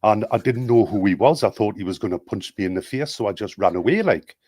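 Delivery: broad Geordie storytelling; lively put-on Newcastle Geordie accent. Shown as a demonstration. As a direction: tell it as a Geordie, lifting the tagged-on "like". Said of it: "they add a like".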